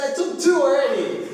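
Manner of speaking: high, loud exclamation; retold loud protest. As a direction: blurt it loudly, acting out the story. Retelling own exclaimed protest.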